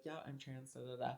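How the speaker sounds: very soft, tossed-off admission; offhand murmur. Retold or imitated. Coming out to mom casually, very soft offhand murmur. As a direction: slip it in barely audibly.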